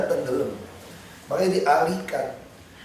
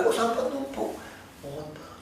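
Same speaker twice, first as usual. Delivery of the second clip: softly spoken; 8 semitones higher, 8 dB quieter than usual. Retold or imitated. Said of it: "halus ngomongnya".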